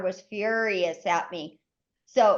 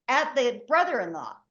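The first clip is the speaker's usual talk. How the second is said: ordinary talk, nothing marked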